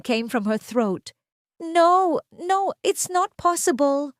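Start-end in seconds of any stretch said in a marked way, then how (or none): 1.6-4.1 high, strangled cry of disbelief; choked disbelief. Acted. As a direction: gasp it out in horror.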